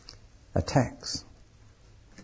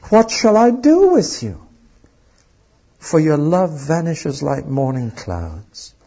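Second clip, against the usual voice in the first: anguished plea; 5 semitones higher, 4 dB louder than usual. Acted. God's anguished plea read aloud.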